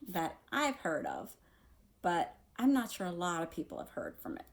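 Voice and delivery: ordinary delivery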